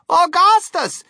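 high, loud motherly yell; exasperated mother yelling for her son; mother's cry. A narrator's reading. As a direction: cry the name out at high pitch. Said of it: "cried Missus Gloop".

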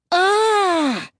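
high, loud frustrated ah; frustrated cry. Acted. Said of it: "tore at her hair in frustration".

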